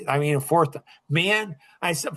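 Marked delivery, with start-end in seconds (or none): none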